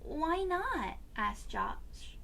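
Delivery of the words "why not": higher, lightly voiced boy's question; a small boy pushing back; child voice. A narrator's reading. Josh questioning his father, higher child voice.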